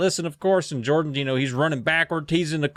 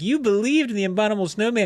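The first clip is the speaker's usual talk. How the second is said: quick, higher-pitched taunt; teasing; 7 semitones higher, faster than usual. Acted. Jordan teases embarrassed Nicole about believing, light taunt. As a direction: needle her in a light sing-out. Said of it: "Jordan teases her about it".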